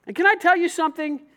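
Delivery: normal talking voice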